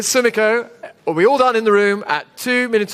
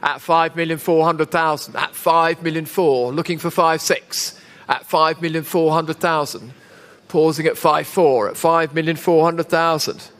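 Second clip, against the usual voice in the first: auctioneer; 3 semitones lower than usual. Real, not acted. Live auction bid calling.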